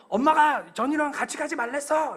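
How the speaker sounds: put-on high, loud childish taunt; kids' shout. Kids shouting at the pastor's son, teasing. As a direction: call it out across the street.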